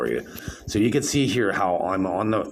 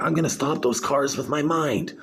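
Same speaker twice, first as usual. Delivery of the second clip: higher, mock-serious sarcastic line; 9 semitones higher than usual. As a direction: put on a sarcastic voice, pitched up. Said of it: "And then I changed my voice".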